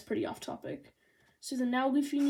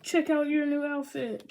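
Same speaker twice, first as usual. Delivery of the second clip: showy presenter; 5 semitones higher, 5 dB louder than usual. Acted. Acting katana man's showy line.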